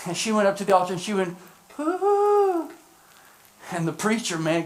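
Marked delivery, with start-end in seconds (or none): 1.8-2.7 high, thin "ooh"; drawn-out high-pitched mock whoop; imitated whoop. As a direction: mimic a woman's sudden high cry at the altar. Imitates lady's cry at altar.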